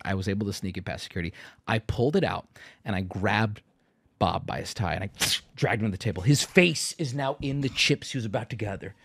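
low, hushed tall-tale narration; mock-dramatic storytelling. Streamer making up a better ending for the audience, mock-dramatic hush. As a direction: play it as a quiet, menacing thriller, tongue in cheek.

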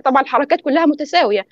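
normal talking voice

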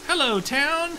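high, cheery hello; high greeting voice. Pretend. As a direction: call out to the town brightly. Greeting the town.

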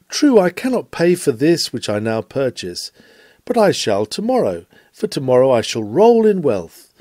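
ordinary talk, nothing marked

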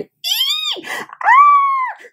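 put-on very high piercing kid's shriek; terrified shriek. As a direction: shriek in sudden fright. The scaredy cats get frightened.

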